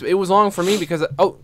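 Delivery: ordinary talk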